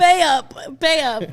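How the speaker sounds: loud, high gangster shakedown; punchy, playful shouted demand; mock demanding shout. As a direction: holler it like a joking debt collector. Joins in voicing the gang's demand.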